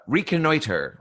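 high, theatrical villain voice; Monarch. Acted. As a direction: finish the grand villain pronouncement. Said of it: "And the Monarch says".

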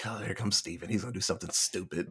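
low, soft, imitating a wary aside; mock weary dread. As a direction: grumble it under your breath, as if a screw-up approaches. Family dreading Steven's arrival, acted out.

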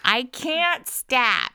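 loud, drawn-out mocking echo; mock wail. Mockingly echoes co-host's apology.

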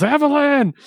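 high-pitched drawn-out call; imitated yell. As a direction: call out the name like a yelling wizard. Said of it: "and he yells".

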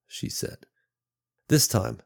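ordinary delivery, nothing marked

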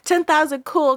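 high, loud little cheer; excited. Real, not acted. Host cheering listeners over ten thousand downloads, excited. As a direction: burst out celebrating.

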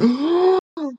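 put-on high shocked gasp of an old lady; hammed-up horrified gasp; mock gasp. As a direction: imitate her scandalized gasp. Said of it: "She was like".

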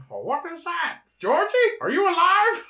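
high, hammy clown voice; Pennywise voice. Acted. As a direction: squeak it like a creepy clown. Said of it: "he goes".

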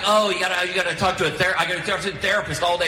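high, sing-song voice of a tired wife; mocking wife voice. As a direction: whine it like an envious mom of toddlers. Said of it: "She's like".

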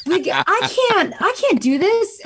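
high, drawn-out mock panic; overwhelmed protest. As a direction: whine that it's beyond you. Retold panic at starting podcast.

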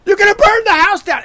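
high, loud panicked shout; shrill panic about the iron burning the house; freaking out. Retold or imitated. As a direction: scream it in total freak-out. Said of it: "total freak out mode".

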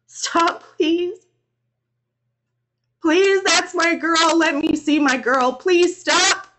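high, loud anguished begging; inconsolable pleading. A narrator's reading. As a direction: beg desperately to be let through. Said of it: "I was inconsolable".

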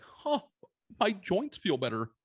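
high, slowed dawning surprise; pleasantly surprised. Retold or imitated. Retelling how his sore back suddenly felt better, high slow surprised discovery. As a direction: let the relief dawn on you slowly.

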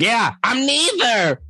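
high, drawn-out mock retort; Gina impression. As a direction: imitate her in a very high, slow voice. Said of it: "And Gina's like".

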